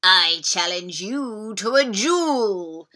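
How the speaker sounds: slow, booming dinosaur challenge. Acted. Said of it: "said the dinosaur".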